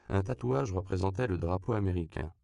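ordinary delivery, nothing marked

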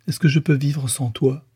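supplication plus aiguë, à peine larmoyante; un soupçon de pleurs dans la voix; sanglots légers. Acted. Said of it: "Elle éclata en sanglots". (Louise en larmes supplie Frédéric, sanglot retenu)

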